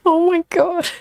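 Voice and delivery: high, giggling exclamation; laughing helplessly. Real, not acted. Overcome with laughter.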